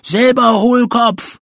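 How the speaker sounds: loud, high, drawn-out comeback; angry retort. A narrator's reading. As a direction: throw the insult right back, loudly. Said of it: "gab Eddy zurück".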